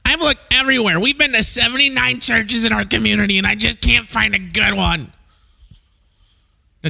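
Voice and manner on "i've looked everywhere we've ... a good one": whiny churchgoer. Retold or imitated. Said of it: "super gracious for these people".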